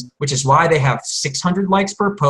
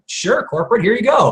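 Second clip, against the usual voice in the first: mock obliging; 7 semitones higher than usual. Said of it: "I'm like".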